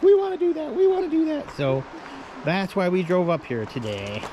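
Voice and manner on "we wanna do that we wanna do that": put-on high, eager kid voice; excited kids. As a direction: chant it like excited children. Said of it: "they're like".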